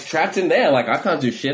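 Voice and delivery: ordinary delivery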